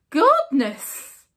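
squeaked. Acted. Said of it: "squeaked Mister Mouse".

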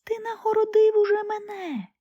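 a little bird answering the emperor; nightingale's voice. Acted. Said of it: "відповів соловей". (голос соловейка)

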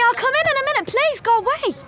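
high, loud brush-off; exasperated shout. Real, not acted. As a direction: shout back to be left alone. Shooing husband away, exasperated.

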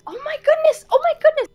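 high, thrilled gushing; delighted high gushing; excited squeal. Real, not acted. Squeals at cute items.

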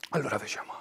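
normal talking voice

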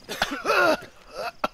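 hammed-up wet, hacking cough; coughing up blood. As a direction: fake a dying man's bloody cough. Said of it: "Coughing up blood".

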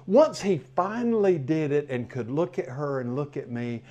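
usual speaking voice

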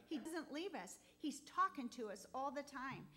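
ordinary talk, nothing marked

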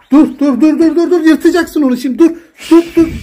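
high, loud, repeated "stop"; urgent stopping. Real, not acted. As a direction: frantically halt a pet mid-mischief. Cat about to tear something.

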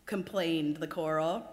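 ordinary delivery

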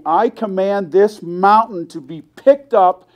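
loud, slow, high proclaiming cry; praising Jesus. Retold or imitated. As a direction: command it out at full force like a revival prayer. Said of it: "he starts praising Jesus".

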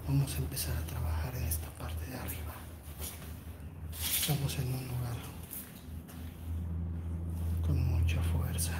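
whispering so the house won't hear; hushed whisper. Real, not acted. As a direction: keep it barely voiced, hushed and careful. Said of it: "No puedo hablar muy fuerte".

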